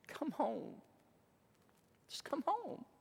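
put-on a hushed, aching call to a lost child; tender parental plea. Parents phone their daughter to come home, tender plea.

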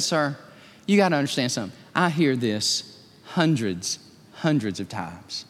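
a humble baker, gently amused; humble old lady. Retold or imitated. Humble baker answers the pastor.

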